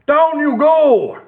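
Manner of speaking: high, loud, slow command; urgent command. Acted. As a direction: call it out as the dragon comes. Horace shouting at dragon.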